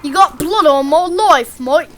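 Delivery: loud, high mock-Australian holler; broad Aussie accent, belted out. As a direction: put on a hammy Australian accent. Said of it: "Australian accents are fun".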